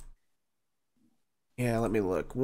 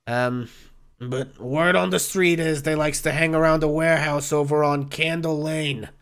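put-on bartender's northeastern US accent. Bartender gives Oz a lead on Floon, northeastern US accent. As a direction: tell it like a northeastern US barkeep. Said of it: "have the exact same accent".

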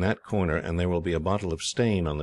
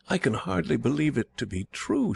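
ordinary delivery

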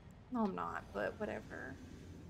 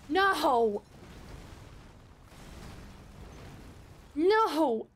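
loud, higher cries of no; frustrated yell; 7 semitones higher, 4 dB louder, slower than usual. Real, not acted. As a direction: yell at the boss in panic. Dies again, yelling.

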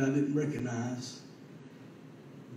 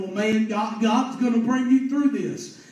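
raised, insistent exhortation; impassioned preaching; 6 semitones higher, 6 dB louder than usual. Real, not acted. Preacher urges a despairing man to trust God, impassioned. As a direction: build higher and louder to reassure with force.

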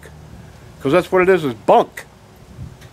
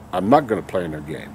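lower, softer and quicker, almost to himself; muttered repeat; 9 semitones lower, 6 dB quieter, faster than usual. Real, not acted. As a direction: drop it under the breath as a repeat. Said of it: "I'm no longer gonna play".

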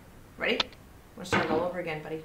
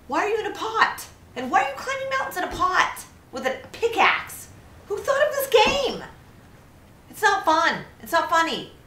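high-pitched, raised incredulous protest; exasperated rant; 11 semitones higher, 5 dB louder than usual. Real, not acted. Player railing at the absurd game, high exasperated rant. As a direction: rail at it, voice pitched way up.

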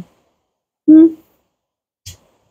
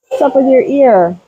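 usual speaking voice

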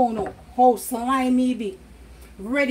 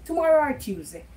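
ordinary talk, nothing marked

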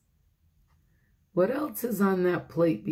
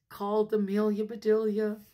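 usual speaking voice

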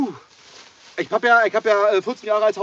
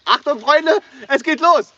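excited announcement; 8 semitones higher, 7 dB louder, slower than usual. Real, not acted. Announcing can opening to viewers.

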